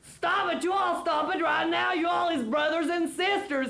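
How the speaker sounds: high shouted "stop it"; screaming. A narrator's reading. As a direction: yell at them to stop. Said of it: "a screaming".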